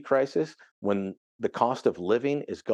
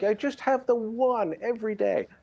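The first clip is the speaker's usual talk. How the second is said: put-on much higher, sweet little old lady; 11 semitones higher than usual. Commentator imitating a hundred-year-old lady: high and sweet. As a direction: play a centenarian sharing her secret. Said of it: "and it's cute".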